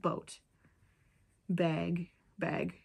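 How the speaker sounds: "Boat" and "bag" in Minnesotan vowels; isolated regional "Boat", "bag"; Minnesotan pronunciation. Shown as a demonstration. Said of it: "do we say that weird?".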